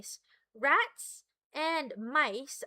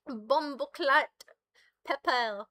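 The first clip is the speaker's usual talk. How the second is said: mock Jamaican accent; slower than usual. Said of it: "Or a Jamaican one?".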